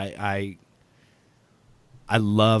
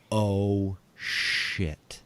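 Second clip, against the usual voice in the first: hushed, lower, sinking realisation; pin-drop dread; 4 semitones lower, 5 dB quieter than usual. Retold or imitated. Owner realises the caller may be genuine, hushed dread. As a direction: let it drop away quietly. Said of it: "that pin drop moment".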